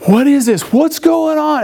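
high-pitched acted panic; panicked, rising alarm; freaking out. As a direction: imitate bewildered people, pitch climbing with each question. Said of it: "they're all freaking out".

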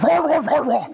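high, laughing roar from the dog; hammed-up dog bark; mock dog roar. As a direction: play the charging dog, half laughing. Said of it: "that dog's like".

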